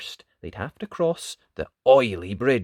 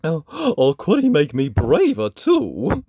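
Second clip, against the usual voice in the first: sniffly lion; 3 semitones higher, 6 dB louder than usual. Acted. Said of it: "Lion sniffled".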